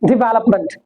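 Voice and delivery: "डेवलपमेंट" as di-VEL-up-munt; short "di" opening and weak ending in "डेवलपमेंट"; correct pronunciation. Shown as a demonstration. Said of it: "correct pronunciation इसकी क्या होगी". ("डेवलपमेंट" को डिवेलपमंट)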